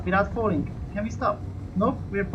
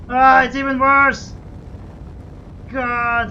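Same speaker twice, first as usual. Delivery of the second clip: frustrated shout; 4 semitones higher, 7 dB louder, slower than usual. Real, not acted. Turning gyroscopes off worsens spin.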